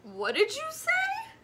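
put-on high eager children's question; eager class. A narrator's reading. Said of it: "the class asked eagerly".

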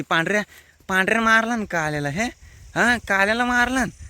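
high, excited egging on of a bullfight; real high-pitched cheering from the sidelines; cheering bulls on. Real, not acted. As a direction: yell encouragement at the fighting bulls.

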